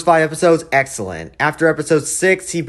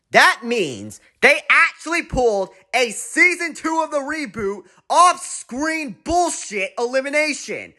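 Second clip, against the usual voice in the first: rising rage; 11 semitones higher than usual. Real, not acted. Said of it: "This is where the rage starts".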